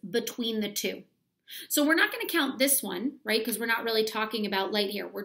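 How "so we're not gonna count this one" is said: normal talking voice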